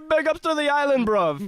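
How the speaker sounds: a Brit faking Jamaican slang; British-Jamaican mix. Retold or imitated. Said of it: "a British person, like, going to Jamaica".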